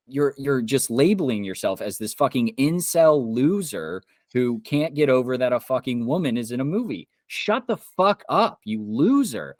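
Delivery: genuinely fed up, lashing out; furious rant. Real, not acted. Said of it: "I'm so angry right now".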